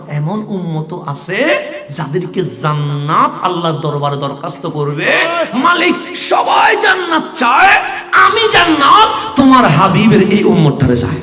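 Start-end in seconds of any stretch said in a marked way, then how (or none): 5.5-11.2 high, loud, ringing sermon cry; full-force waz preaching shout; fiery preaching. Acted. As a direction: preach it at the top of your voice, stretching the quote. Paradise pleading, preached aloud.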